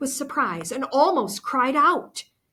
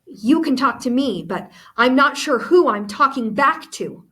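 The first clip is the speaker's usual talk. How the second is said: louder, cold accusing retort; bitter and angry; 4 semitones higher, 8 dB louder than usual. Acted. Goethe to Anna, who she thinks betrayed her, bitter and angry. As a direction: spit it back at a former friend. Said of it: "My words were bitter and angry".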